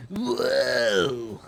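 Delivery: loud, drawn-out cartoon whoa; Otto. Imitating a Simpsons character, loud Otto impression. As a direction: blurt it big in a put-on voice. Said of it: "So Otto from The Simpsons be like".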